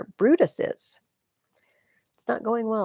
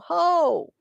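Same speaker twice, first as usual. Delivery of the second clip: put-on young soldier roaring his name across the field; battle cry; 11 semitones higher, 8 dB louder than usual. Young Cato to the enemy, a defiant battle shout. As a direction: bellow it like a charge.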